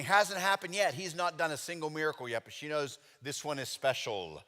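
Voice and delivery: ordinary talk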